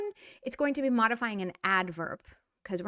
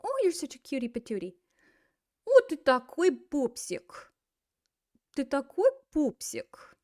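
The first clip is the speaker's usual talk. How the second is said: put-on baby talk; 4 semitones higher than usual. Adult looking at a little Russian kid, cooing baby talk. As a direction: gush over an adorable baby. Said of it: "look at a little Russian kid and say".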